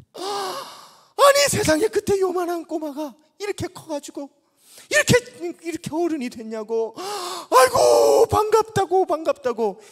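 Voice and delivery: high, loud cry of amazed delight; astonished joy. Retold or imitated. Old pastor amazed to see the grown boy, overjoyed. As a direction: exclaim in disbelief at how he's grown. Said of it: "목사님이 너무너무 놀라가지고".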